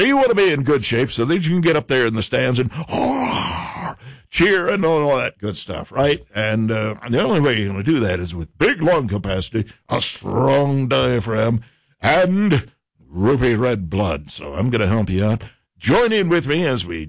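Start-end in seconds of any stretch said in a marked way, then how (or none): 2.8-4.1 big gasp. Pretend. Mock breathing exercise. 9.9-14.3 slow emphasis. Acted.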